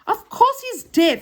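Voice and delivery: high, loud, slow statement of the obvious; emphatic. Acted. Joe points out that Saucepan is deaf, emphatic.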